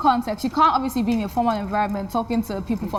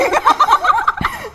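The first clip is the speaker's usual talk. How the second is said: high, loud burst of laughter; big laugh; 7 dB louder than usual. Real, not acted. Caught out by friends, bursting into loud laughter. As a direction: crack up at your friend's teasing.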